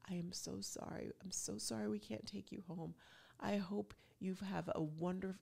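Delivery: soft, hushed apology to a puppy; tender whisper. Retold or imitated. Husband whispers sorry to the puppy they can't take home, tender hush. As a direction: whisper it gently, like saying goodbye to a pup.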